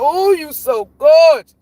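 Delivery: high, loud, drawn-out gushing as Grandma; mock-sweet grandma. Grandma gushing over her grandchild before the insult, mock-sweet. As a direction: imitate Grandma laying on a big slow compliment. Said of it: "she'll switch it up".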